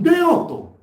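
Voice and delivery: a single burst of a word; shout. Real, not acted. Preacher driving his point home to the congregation, shouting. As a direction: bark 또 at the congregation.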